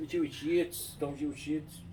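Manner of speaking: playful imitation of a Rio accent; Carioca accent. Mimicking cariocas for an amused listener, Carioca accent. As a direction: say it like a carioca, lifting the pitch. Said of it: "os cariocas, né? Jiu-Jitsu".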